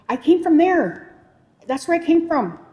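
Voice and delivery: put-on high, loud little-boy announcement; young son's voice. Small son pointing his mom to the stars, high and loud. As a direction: proclaim it like a small child. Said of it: "And he goes".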